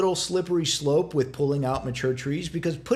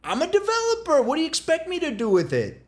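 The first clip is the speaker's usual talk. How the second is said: smug developer as scorpion; 11 semitones higher than usual. Retold or imitated. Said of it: "Scorpion saying".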